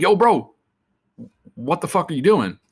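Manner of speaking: loud, high shout across the lot; calling out. Retold or imitated. Calling out to the fired coworker from the car, loud and high. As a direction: yell it out the car window at him.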